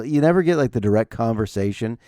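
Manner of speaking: ordinary delivery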